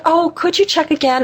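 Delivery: high, imploring request; sweet pleading. Retold or imitated. Guest retelling her plea to innkeeper, high and coaxing.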